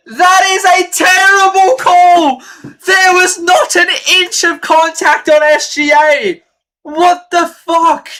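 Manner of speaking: high, loud, slow furious yelling at the refs; furious shout. Real, not acted. Fan streamer screaming at the refs over a foul, furious. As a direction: scream your outrage at the call. Ranting at referees' call.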